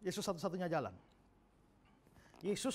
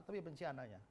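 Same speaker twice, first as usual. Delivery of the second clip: ordinary talk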